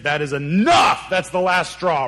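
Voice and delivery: sudden exasperated shout; fed-up parent. Acted. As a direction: lay down the law to the kids, loud. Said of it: "that moment all parents have with their kids".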